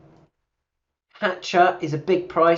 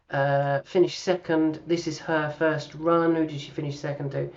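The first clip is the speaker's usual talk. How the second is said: normal talking voice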